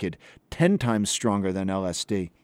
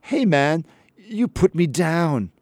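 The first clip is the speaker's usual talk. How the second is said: high, slow, whiny hippie plea; whining voice; 6 semitones higher, 4 dB louder, slower than usual. Retold or imitated. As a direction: whine it out like a wounded stoner. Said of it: "I heard his whining voice saying".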